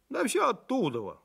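high-pitched, dismissive grumble; grumbling. A narrator's reading. Feldsher answers the puzzled doctor, grumbling. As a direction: toss it off with irritation. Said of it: "буркнул Максимыч".

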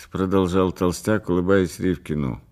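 ordinary delivery, nothing marked

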